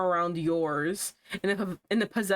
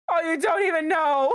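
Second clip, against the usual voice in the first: high, loud, exaggerated exclamation; hammed-up shout of a dramatic reveal; mock outburst; 8 semitones higher, 5 dB louder than usual. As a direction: belt the line out like a melodramatic confession. Mock-exclaiming the line after misreading.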